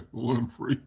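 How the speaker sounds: usual speaking voice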